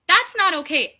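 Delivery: loud moral stand against cheating husbands; emphatic protest. Real, not acted. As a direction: plant your foot down. Condemning husbands who abandon wives.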